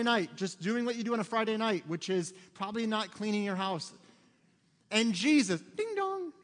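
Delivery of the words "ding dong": high chime imitating a doorbell; much higher two-note doorbell; sung doorbell ding dong. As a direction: sing it like a doorbell.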